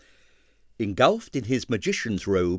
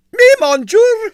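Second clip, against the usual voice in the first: high, loud and slow despairing cry; exasperated ballerina; 13 dB louder, slower than usual. A narrator's reading. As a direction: wail it to the heavens. Exasperated French exclamation.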